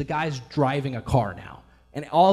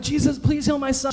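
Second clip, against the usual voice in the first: frantic plea; 10 semitones higher, 4 dB louder than usual. Retold or imitated. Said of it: "So if I'm like".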